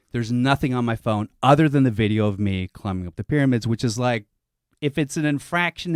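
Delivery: usual speaking voice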